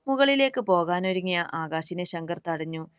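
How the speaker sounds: usual speaking voice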